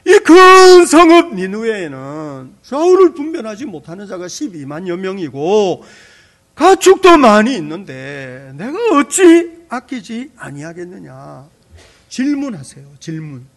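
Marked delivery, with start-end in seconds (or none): 0.0-11.5 loud, slow, weighty proclamation; emphatic preaching. Acted. Pastor repeats God's words to the congregation, loud and slow. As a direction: drive each word home from the pulpit.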